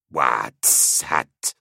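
creature giggling the words back. Acted. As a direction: giggle it like a mocking monster. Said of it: "it giggled".

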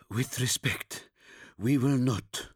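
weary, worn-out soldier; exhausted. Acted. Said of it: "He sounded exhausted".

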